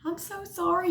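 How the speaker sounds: high, soft mock apology; apologizing. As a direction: squeak out a flustered sorry. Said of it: "He was all apologizing".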